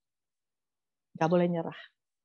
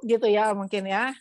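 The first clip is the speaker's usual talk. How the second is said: normal talking voice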